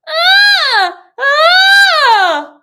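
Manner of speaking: very high loud crying wail; imitated baby cry. Shown as a demonstration. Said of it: "of that cry".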